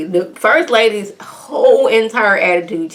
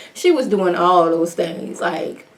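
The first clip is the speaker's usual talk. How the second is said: ordinary delivery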